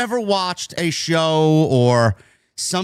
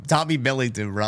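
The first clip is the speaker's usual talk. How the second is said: usual speaking voice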